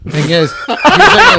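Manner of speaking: ordinary delivery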